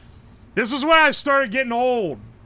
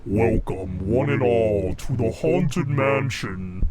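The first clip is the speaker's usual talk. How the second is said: deep, slow haunted host voice; booming spooky ghost host; voice changer; 11 semitones lower, slower than usual. Shown as a demonstration. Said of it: "where's my voice changer".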